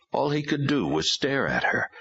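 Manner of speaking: usual speaking voice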